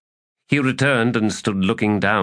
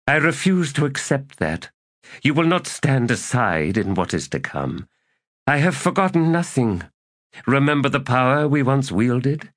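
a lightly voiced errant, higher and insistent; scheming elder god; 8 semitones higher than usual. A narrator's reading. Errant to Knuckles, lightly voiced and insistent. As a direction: coax an old ally back to power.

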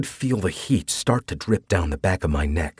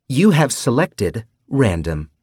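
slow, bright system announcer voice; cutesy AI voice; 4 semitones higher than usual. A narrator's reading. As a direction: read it like a sweet game system voice. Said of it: "The cutesy voice of Nina".

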